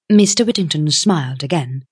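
usual speaking voice

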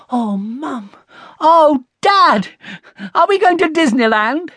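put-on high, excited child voice; thrilled guessing. A narrator's reading. Connie guessing the big treat, thrilled. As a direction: build from a gasped hope to a loud squeal.

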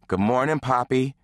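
put-on sweet little girl. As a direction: greet Daddy sweetly like a small girl. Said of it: "Miika said sweetly".

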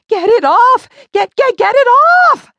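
high, loud, stuttering frantic cry; panicked yell. A narrator's reading. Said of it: "tossed her head from side to side and yelled".

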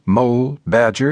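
ordinary talk

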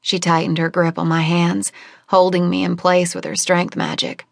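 ordinary delivery

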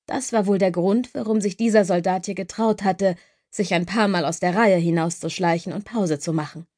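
usual speaking voice

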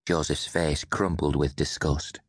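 ordinary delivery

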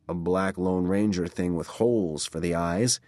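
ordinary delivery

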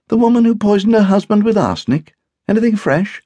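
high, loud wifely voice; inquisitive wife. A narrator's reading. Wife asks husband about the poisoning case, inquisitively. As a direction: ask keenly, pitched well up.

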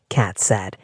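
usual speaking voice

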